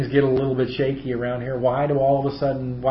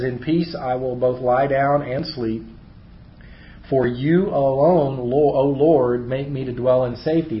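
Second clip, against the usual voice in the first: scripture reading; 2 semitones higher, 5 dB louder than usual. Acted. Reads a psalm aloud.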